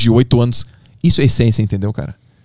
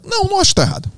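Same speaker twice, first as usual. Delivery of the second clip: put-on very high-pitched kid talking back; squeaky mock protest; defiant boy; faster than usual. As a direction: imitate a boy stubbornly standing his ground. Retold boy talks back to coordinator.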